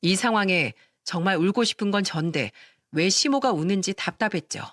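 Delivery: plain reading voice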